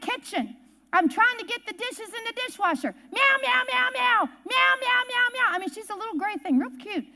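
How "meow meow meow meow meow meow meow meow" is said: high, monotonous imitated cat nagging; meowing cat. Cat hounding owner at the dishwasher, high imitated meowing. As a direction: pester with meow after meow, pitched high. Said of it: "she would meow after you".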